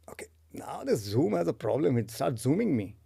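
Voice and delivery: hushed whisper; whispered aside. Real, not acted. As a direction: mutter it under your breath. Whispered aside about zoom.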